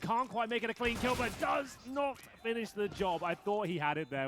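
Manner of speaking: caster rising to a loud, high call; building excitement. Real, not acted. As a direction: build the hype kill by kill. Caster builds through the clutch.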